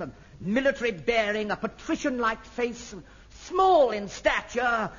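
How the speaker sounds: ordinary talk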